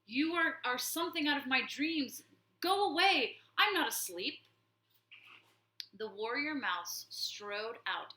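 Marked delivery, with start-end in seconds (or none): 0.0-4.4 high, loud panicked babble; terrified warlord. Acted. Cowering warlord begging the warrior to vanish, high panic. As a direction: cower and shriek at the vision. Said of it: "his mouth working convulsively".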